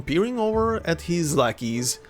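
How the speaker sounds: ordinary talk, nothing marked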